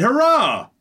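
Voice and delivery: high, delighted cry of hurrah; a hammed-up happy hurrah; joyful cheer. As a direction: cheer it like a thrilled young lover. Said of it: "threw their hands in the air and said".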